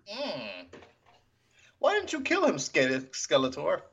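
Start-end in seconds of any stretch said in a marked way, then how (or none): 1.8-3.8 high, dreamy mock swoon; swooning admirer. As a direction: coo it like a smitten fan. Voicing a smitten character.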